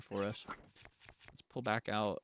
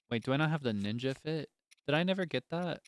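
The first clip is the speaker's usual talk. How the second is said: normal talking voice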